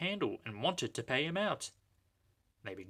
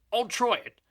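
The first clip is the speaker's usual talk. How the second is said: high, louder little engine voice; eager engine; 11 semitones higher, 6 dB louder than usual. A narrator's reading. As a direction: agree eagerly. Sir Handel agreeing.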